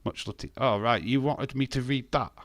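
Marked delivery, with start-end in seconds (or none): none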